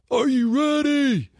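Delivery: high, slow, drawn-out hype call; instructor hyping. Retold or imitated. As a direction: stretch it out like a pumped-up instructor.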